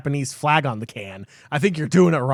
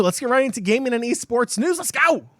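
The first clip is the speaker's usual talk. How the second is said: excited; 9 semitones higher than usual. Real, not acted. Kicking off the news segment.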